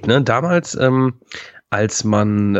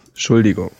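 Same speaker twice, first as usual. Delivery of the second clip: ordinary talk, nothing marked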